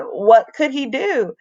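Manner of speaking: plain reading voice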